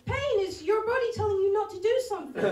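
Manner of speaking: normal talking voice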